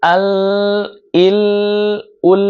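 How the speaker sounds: আল, ইল, উল each with a long l; slow held lam. Shown as a demonstration. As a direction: draw out the final ল in আল, ইল, উল. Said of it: "একটু ধীরে উচ্চারণ করতে হয়". (আল, ইল, উল-এ ল টেনে ধরে)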